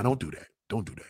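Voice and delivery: ordinary delivery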